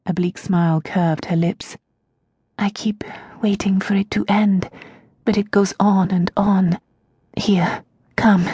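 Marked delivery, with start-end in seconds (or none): 2.6-4.7 hushed, breathless whisper; barely voiced words of despair. Prisoner Tasia to her servant, whispered. 7.4-8.4 hushed, breathless whisper; barely voiced words of despair. Prisoner Tasia to her servant, whispered.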